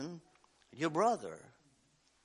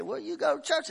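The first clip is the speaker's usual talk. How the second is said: lightly voiced man asking, pitched up; mock questioning voice; 13 semitones higher, 5 dB louder than usual. Preacher retelling the man's question, lightly pitched up. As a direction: retell his question with a lifted voice.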